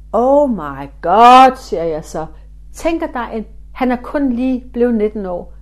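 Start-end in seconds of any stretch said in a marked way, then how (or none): 0.1-1.6 slow, loud drawn-out gasp of amazement; stunned slow exclamation; astonished. Retold or imitated. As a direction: let the shock stretch each word. Astonished at spirit's name.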